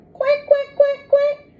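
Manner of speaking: high little quacks; duckling quacks. Acted. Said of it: "say the ducklings".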